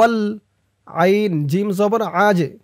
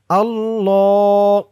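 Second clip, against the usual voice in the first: recited slowly; much slower than usual. Shown as a demonstration. Teacher showing pronunciation to viewers, slow chant. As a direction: stretch it out in a chant. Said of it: "আমি যেটা বলে দিব, আপনারা সেটা বলবেন".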